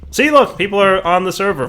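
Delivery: high, loud delighted exclaim; excited. Real, not acted. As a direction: point it out thrilled. Server full of players.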